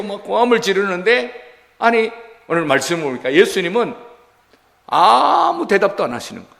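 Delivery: normal talking voice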